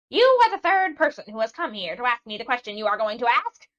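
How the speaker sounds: loud, high cackling screech; an old witch screaming at the sailor. A narrator's reading. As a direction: screech it at the visitor. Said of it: "screamed the Witch of the Sands".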